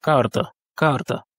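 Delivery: carta in Russian, stress on the first A; Russian pronunciation. Shown as a demonstration. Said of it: "L'accent tonique est sur le A".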